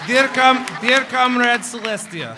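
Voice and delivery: loud, high mock Russian accent; hammed-up Russian accent dictating a letter. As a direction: boom it out as a Russian Twilight Sparkle. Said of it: "I can do a Russian accent".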